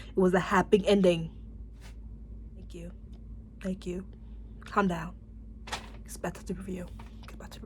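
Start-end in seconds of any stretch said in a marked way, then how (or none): 2.6-7.6 very soft, breathy whisper to herself; whispered self-calming. Real, not acted. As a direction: hush yourself back to the review.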